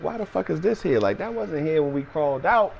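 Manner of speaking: high, confused voice; puzzled. Retold or imitated. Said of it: "So I'm like".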